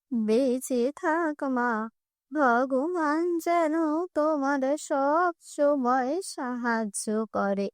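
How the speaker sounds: put-on very slow, frail old woman begging; old beggar woman. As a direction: plead for food slowly and weakly.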